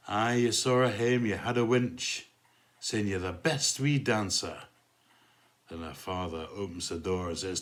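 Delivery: rhythmic poem recital in put-on Glasgow accent; amateur Glasgow accent for a dance-hall poem; bad Glasgow accent. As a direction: recite comic verse in broad mock Glaswegian. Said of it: "sorry about the appalling Glasgow accent".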